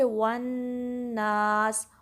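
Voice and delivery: वन नास with rounded lips; recitation demo. Shown as a demonstration. Teacher to students, demonstrating the final word. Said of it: "वाव के लिए होंठों को गोल करना है".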